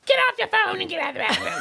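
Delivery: high, fast exasperated nagging; mock parental yell. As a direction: scold the kids in a hammed-up high rush. Parent imitates yelling at kids.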